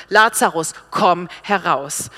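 forceful, slow call with a loud first word; loud command. Acted. Jesus commands Lazarus with full authority, loud call. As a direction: order him out firmly, not pleading. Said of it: "Er sagt es mit lauter Stimme".

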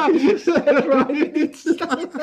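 high, squeaky words through giggles; helpless real laughter while talking; cracking up. Real, not acted. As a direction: barely get it out for laughing. Cracking up at the image.

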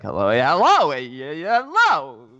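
each hello at a different loudness and pitch; uneven volume mimic. Imitating over-edited takes where the volume jumped around. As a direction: mimic badly levelled recordings, jumping around. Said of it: "So it'd be like".

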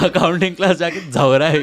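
laughing loudly through it. Real, not acted. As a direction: crack up mid-line. Laughing joke.

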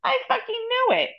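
high-pitched, vehement insistence; emphatic certainty. Real, not acted. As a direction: pitch leaps up in total conviction. Insisting she knew at once.

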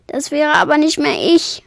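high, loud tearful protest; acted sobbing outburst. A narrator's reading. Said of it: "schluchzte sie".

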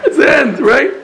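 high, loud words through laughter; laughing. Real, not acted. Teacher repeating the punchline to listeners, laughing. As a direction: still cracking up as you repeat it.

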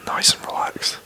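hushed podcast whisper, breathy and close. Real, not acted. Whispers all through podcast.

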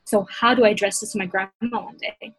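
normal talking voice

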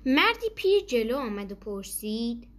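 ordinary delivery, nothing marked

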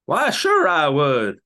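slow, loud mock Southern Texas drawl; Texan accent. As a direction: stretch it out like a hammy cowboy. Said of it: "Southern Texas accent".

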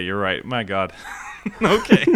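usual speaking voice

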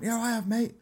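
a high-pitched mock voice for a bloke in the audience; bloke in the crowd. Comedian imitating a bloke calling from the back row, high mock voice. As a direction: chirp it out like a cheery punter shouting from the back.

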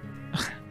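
long unvoiced sigh of defeat; heavy weary sigh. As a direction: sigh out in resignation. Resigned to the voice's command.

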